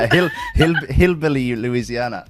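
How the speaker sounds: stammering through real laughter; laughing. Real, not acted. Joking about a botched Australian accent, laughing. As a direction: crack up on the first word, then draw it out.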